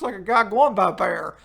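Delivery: ordinary talk, nothing marked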